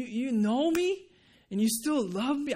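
high-pitched, incredulous wonder; retold disbelief. Retold disbelief at being loved.

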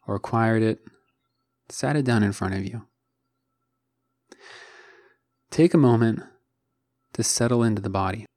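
unhurried meditation guide with long pauses; measured, calm instruction, leaving space between phrases; guided meditation. Acted. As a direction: guide listeners gently into the body, phrase by phrase.

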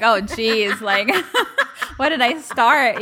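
laughing through oh jeez; amused disbelief, laughing as she talks; laughing exasperation. Retold or imitated. Mom's exasperated reaction, laughing.

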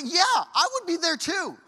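high, loud, slow exclamation; emphatic agreement. Acted. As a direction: blurt out a drawn-out, high-pitched admission. Acting own scared reaction to story.

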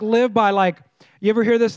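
ordinary delivery

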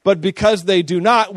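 ordinary talk

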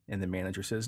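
normal talking voice

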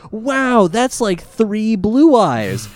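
put-on high, excited child voice; amazed kid. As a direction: gasp it out in awe. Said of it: "and he goes".

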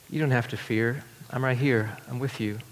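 soft, reassuring comfort; tender. Retold or imitated. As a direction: reassure them quietly. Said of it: "in a very tender way".

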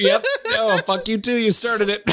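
half crying, half laughing; laughing through tears. Real, not acted. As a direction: laugh it out, choked up. Tearful laughing reply.

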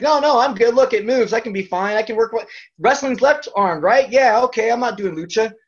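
high, loud, eager insisting; mock eager protest. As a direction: brush off the injury, way too eager. Insisting he's fine after injury.

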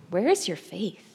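soft, gentle question; Jesus. Retold or imitated. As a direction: ask it quietly of frightened followers. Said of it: "Jesus looks at the disciples and asks them".

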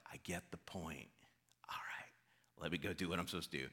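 Jonah whispering he gives in, the last line rushed; resigned whisper. Retold or imitated. Preacher voicing Jonah giving in to God: hushed, resigned whisper.

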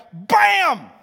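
high, loud bang cry; hammed-up bam as the rock is hit; loud mock bam. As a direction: smack it out like a strike. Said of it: "he was like".

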